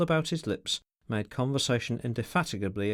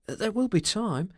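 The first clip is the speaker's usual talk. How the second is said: ordinary delivery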